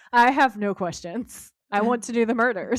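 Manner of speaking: hammed-up eager murder enthusiasm; eager killer. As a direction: relish it, a happy-to-kill angel. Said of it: "He's like".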